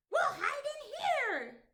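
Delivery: urgent little goblin. Acted. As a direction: goblin hurrying his friend into the cave. Goblin hides with Skeleton.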